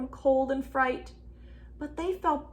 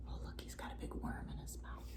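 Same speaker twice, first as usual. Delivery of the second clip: whisper; 18 dB quieter, faster than usual. Acted. Aside to children, whispering.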